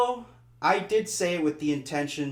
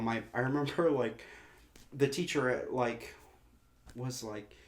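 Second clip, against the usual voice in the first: normal talking voice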